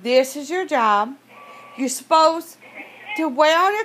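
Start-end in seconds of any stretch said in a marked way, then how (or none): none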